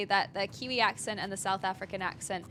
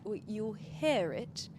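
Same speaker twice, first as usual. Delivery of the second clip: British accent demo; 6 dB quieter, slower than usual. Shown as a demonstration. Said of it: "instead of like".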